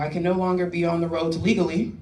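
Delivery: ordinary talk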